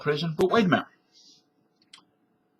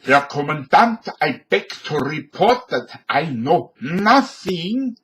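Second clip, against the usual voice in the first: put-on a light German accent, slow and a bit louder; Sergeant Schultz impression; 2 semitones higher, 4 dB louder than usual. Schultz reports to his commandant, mild German accent. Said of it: "Sergeant Schultz".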